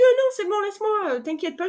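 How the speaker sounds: cheerful retold brush-off. Telling her husband she's fine alone, high and excited. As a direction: jump high to wave off the help, beaming.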